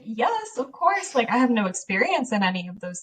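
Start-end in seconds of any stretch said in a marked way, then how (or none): 0.0-1.2 high, breathless eager acceptance; much higher, grabbing the chance; eager yes. Retold or imitated. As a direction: jump at the offer. Eagerly accepting boss's offer.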